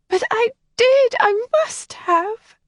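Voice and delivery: put-on a sheltered girl close to tears; upset young lady. Helen confides in Kathleen, high and distressed.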